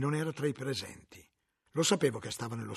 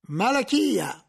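a firm, raised call of the name; stern summons; 9 semitones higher, 8 dB louder, much slower than usual. Acted. Inquisitor calls the librarian forward, stern summons. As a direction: summon him with authority.

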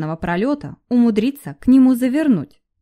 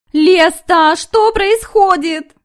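frightened squeal; 9 semitones higher, 7 dB louder, much slower than usual. A narrator's reading. Frightened shadow cries out.

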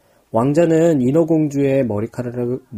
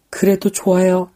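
trembling voice; 5 semitones higher than usual. Acted. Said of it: "떨리는 목소리로 대답했어".

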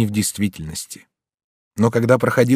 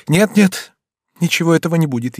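high, agitated reassurance; urgent denial; 7 semitones higher, 3 dB louder than usual. A narrator's reading. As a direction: take it back in a rush of feeling. Mother hurriedly takes words back. (взволнованно, горячо)

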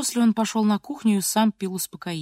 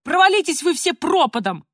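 loud, high parting curse; acted hysterical cry; hysterical yelling; 7 semitones higher, 5 dB louder than usual. A narrator's reading. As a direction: hurl it at the whole family. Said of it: "истерично завопила Полина".

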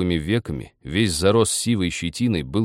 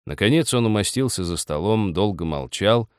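plain reading voice

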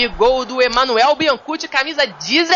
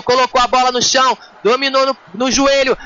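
ordinary delivery

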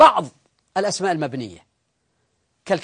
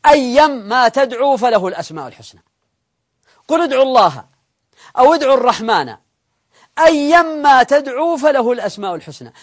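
raised, emphatic verse recitation; higher, louder deliberate recital; Quran recitation; 4 semitones higher, 6 dB louder than usual. Acted. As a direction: recite the verse firmly, falling at each phrase end. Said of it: "قال الله تعالى".

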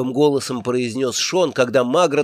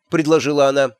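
plain reading voice

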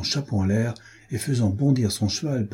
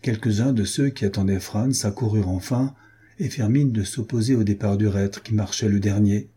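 usual speaking voice

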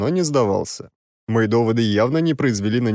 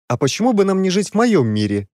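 angry retort; 8 semitones higher, 3 dB louder than usual. Acted. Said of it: "сердито посмотрел на меня Сигизмунд".

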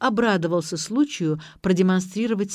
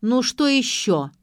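higher, slow, exasperated question; indignant; 7 semitones higher, 4 dB louder, slower than usual. A narrator's reading. Vik is interrupted by the old man, indignant. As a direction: stretch it out in annoyance. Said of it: "возмутился Вик".

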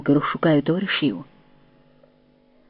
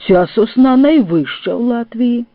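higher-pitched, weighty pronouncement; deeply thoughtful; 6 semitones higher, 4 dB louder than usual. A narrator's reading. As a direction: announce it as a profound discovery. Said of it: "глибокодумно мовив кудрявий".

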